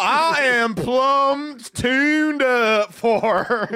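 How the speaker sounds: hammed-up Southern host voice; southern accent. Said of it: "Don't do anything in a southern accent".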